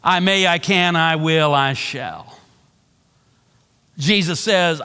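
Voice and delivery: usual speaking voice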